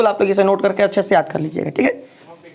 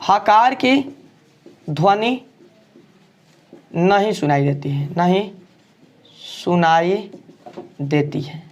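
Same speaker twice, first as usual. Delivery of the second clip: हकार की ध्वनि नहीं सुनाई देती है dictated word by word with long pauses; slow dictation; 5 semitones lower, slower than usual. Shown as a demonstration. Teacher dictating the definition for students to note, slowly. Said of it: "इसका definition हम लिख देते हैं".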